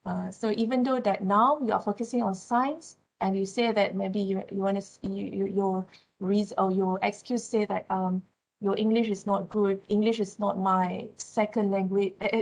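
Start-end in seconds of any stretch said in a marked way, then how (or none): none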